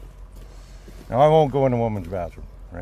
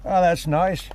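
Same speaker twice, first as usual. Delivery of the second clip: casual Duncan; 7 semitones higher, 4 dB louder, slower than usual. Retold or imitated. Duncan voiced in story.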